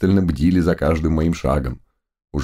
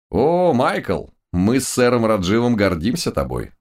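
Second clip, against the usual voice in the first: high, proud proclaiming; proud announcement; 6 semitones higher than usual. A narrator's reading. Teacher revealing himself to Michael, proudly. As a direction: announce it with pride. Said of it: "гордо возвестил мой учитель".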